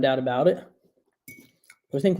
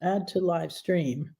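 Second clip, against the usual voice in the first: ordinary talk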